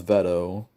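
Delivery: "Veto" with a short e, as in "vet"; "Veto" pronounced with first syllable like "vet". Shown as a demonstration. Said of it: "I think it's pronounced Veto".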